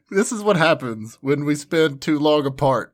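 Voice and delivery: normal talking voice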